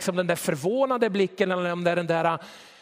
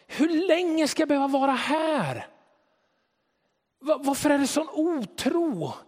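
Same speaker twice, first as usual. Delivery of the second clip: higher, anguished questioning; despairing Jesus; 7 semitones higher than usual. Retold or imitated. Jesus to the crowd, despairing and higher. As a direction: cry out in frustrated despair. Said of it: "Jesus blir förtvivlad".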